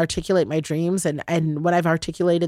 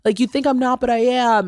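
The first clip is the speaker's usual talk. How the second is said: higher mock-defensive husband voice; hammed-up protesting partner, pitched up; imitating husband; 7 semitones higher than usual. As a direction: play the husband insisting he's listening. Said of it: "He's like".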